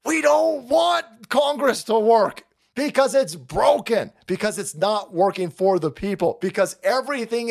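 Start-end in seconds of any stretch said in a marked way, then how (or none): 0.1-4.1 shouting. Real, not acted. Vlogger yells at pundit that Congress is broken. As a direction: drive it home, yelling. Rant peak at Keith.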